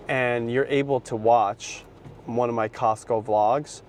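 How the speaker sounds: normal talking voice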